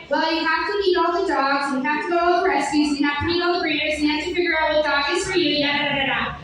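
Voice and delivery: fast rattled-off explanation; clocked-in customer service. Retold or imitated. Clerk explaining dog buying to the customer, fast patter. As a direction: rattle off the list like a weary clerk. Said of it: "clocked in Rachel is like".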